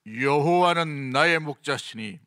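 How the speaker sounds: slow, resonant choir-style projection; big belly-supported voice, slow and sustained; projected from the belly. Shown as a demonstration. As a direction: push it out from the diaphragm, drawing out the words. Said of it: "목소리가 탁 배에서 나오도록".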